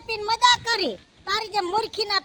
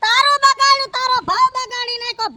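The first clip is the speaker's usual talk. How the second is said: angry retort; 5 semitones higher, 4 dB louder than usual. Acted. Aunt scolds nephew angrily.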